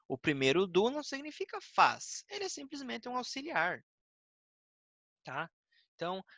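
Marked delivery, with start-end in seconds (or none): none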